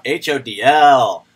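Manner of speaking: loud, slow letters like the robot; imitating the robot. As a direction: spell it out like a machine. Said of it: "I turned the volume up".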